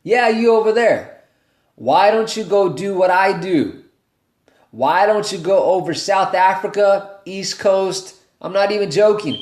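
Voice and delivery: high, loud call across the room at someone in the back. Retold or imitated. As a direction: shout across the crowd to pick someone out. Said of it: "he goes".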